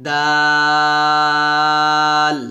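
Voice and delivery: দাল with a light D and long, level "aa"; long-drawn, thin দাল; thin soft D. Shown as a demonstration. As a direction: stretch দাল out evenly. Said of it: "দাল উচ্চারণ করার সময় আমরা পাতলা করব".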